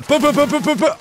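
high excited bu-bu-bu gun noises; mouth gunfire. Real, not acted.

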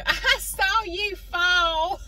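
put-on very slow and high, a teasing kid's singsong; taunting singsong. Little sister teasing her brother who just fell, singsong taunt. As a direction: drag it out to rub it in, like a bratty sister. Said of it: "and you hear me go".

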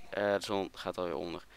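usual speaking voice

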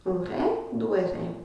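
a quiet echo for the class to copy. Shown as a demonstration. Teacher quietly repeating the example for students. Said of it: "teacher repeats it softly".

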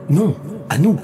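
high, swooping counterclaim; quarrelling chorus voice. A narrator's reading. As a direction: cut in to claim him for the group. Voices quarrel over the man.

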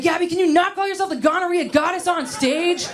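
high, pleading mom voice; nagging mom. Retold or imitated. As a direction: whine it like a fed-up mother. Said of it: "She's like".

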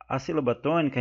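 usual speaking voice

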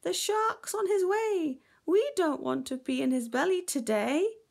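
high, loud frightened fish; worried, high-pitched moan; moaning alarm. A narrator's reading. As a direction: wail it anxiously, high and loud. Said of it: "moaned the mackerel".

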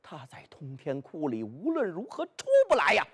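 plain reading voice

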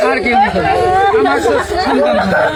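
snotty crying. Real, not acted. Tearful farewell to students.

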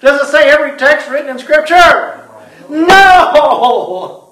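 loud, high challenge building to a long shouted no; hammed-up pulpit outcry; mock incredulous. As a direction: play up the absurd question, then bellow the answer. Preacher answers own mock question loudly.

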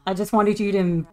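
ordinary talk, nothing marked

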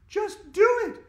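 high, loud impatient order; shout. A narrator's reading. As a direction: snap the order. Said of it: "shouted Andrea".

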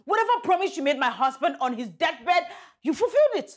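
high, heated outburst; angry dismissal. Acted. Ordering him to leave.

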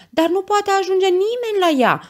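very high, loud cry of alarm; panicked onlooker. A narrator's reading. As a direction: wail it in helpless panic. Panicked onlooker during the rescue. (strigăt de panică, foarte înalt)

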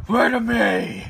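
Batman growl. Acted. Dad quoting Batman to baby Riley, mock stern Batman. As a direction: demand it like Batman, only half serious. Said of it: "he's talking like Batman".

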